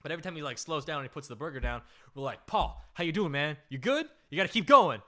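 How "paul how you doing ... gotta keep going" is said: rising to a loud goad; goading push. Retold or imitated. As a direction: push your friend to keep eating. Friends pushing Paul on.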